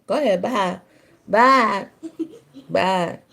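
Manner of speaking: ordinary talk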